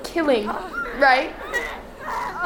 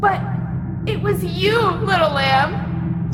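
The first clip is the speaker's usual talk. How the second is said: taunting; 7 semitones higher than usual. Acted. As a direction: savor each word. Villain taunts slowly.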